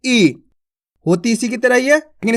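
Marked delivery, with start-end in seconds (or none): 0.0-0.4 ई drawn out as a long 'ee'. Shown as a demonstration. Teacher showing the long ee to students, drawn out. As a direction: stretch ई into a long 'eee'. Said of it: "थोड़ा खींच को होएगा".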